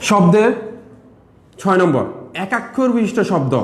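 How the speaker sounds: ordinary delivery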